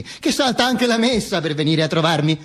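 ordinary talk, nothing marked

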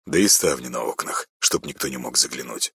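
soft, low concerned voice; quietly cautious; worried quiet. Acted. Андрюха continues worried. (тихо и озабоченно)

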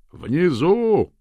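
high shout over roaring wind; loud, desperate holler; bellowing. A narrator's reading. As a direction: yell it to be heard through a gale. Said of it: "заорал джентльмен последней надежды".

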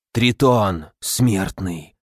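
low, bubbling sea creature voice; gurgling creature voice. Acted. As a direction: gurgle the words like a fish monster. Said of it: "неожиданно пробулькало".

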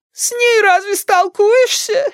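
put-on piercingly high, slow old-woman voice; high granny whine; querulous grandma. A narrator's reading. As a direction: complain in a drawn-out, very high granny voice. Grandmother complains in quarrel.

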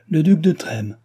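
impatient cry; impatient exclamation. A narrator's reading. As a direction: snap out the name in annoyance. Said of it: "s'écria la comtesse impatientée".

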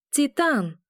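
high shout of the name Титан. A narrator's reading. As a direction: yell Титан desperately. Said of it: "кричу, но он меня не слышит".

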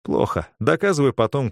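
ordinary delivery, nothing marked

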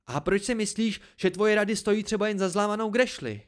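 high, loud, indignant question; exploding. A narrator's reading. Dena rejects the narrator's advice, exploding. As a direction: burst out in anger.

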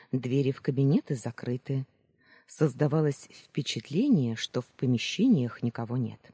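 ordinary delivery

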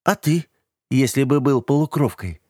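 high-pitched, interested question; mildly intrigued girl; curious. A narrator's reading. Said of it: "заинтересовалась она".

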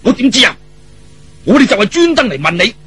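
puffing, indignant retort; huffy retort. Acted. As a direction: snap back, exasperated. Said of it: "呼呼氣氣噉話".

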